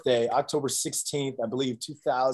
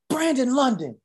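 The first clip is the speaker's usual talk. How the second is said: much higher name called out to the team; calling out; 12 semitones higher than usual. Retold or imitated. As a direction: call him out in front of everyone. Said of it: "And he goes".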